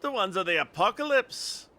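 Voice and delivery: high, dramatic Scottish pronouncement; Scottish accent. Acted. Lookout revealing the trumpets' meaning, Scottish accent. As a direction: proclaim it in a put-on Scottish voice. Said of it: "if my Scottish accent is bad".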